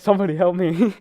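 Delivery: loud, high-pitched retold yell; mock cry for help. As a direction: call out desperately, imitating a teammate.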